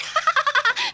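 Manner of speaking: high, deliberate laugh; bright staged ha-ha-ha at a high pitch; demonstration laugh. Shown as a demonstration. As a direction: show someone how to laugh. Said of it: "Please laugh like that".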